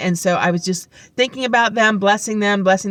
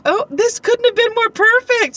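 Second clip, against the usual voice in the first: very high, gushing imitation; all excited; 4 dB louder than usual. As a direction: gush like the thrilled coworker. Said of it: "she was all excited".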